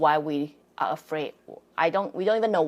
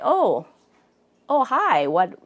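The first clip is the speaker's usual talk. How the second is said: startled; 4 semitones higher, 4 dB louder, slower than usual. Retold or imitated. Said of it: "I'm kind of startled or surprised".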